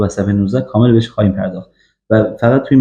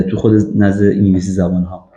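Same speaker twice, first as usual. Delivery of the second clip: normal talking voice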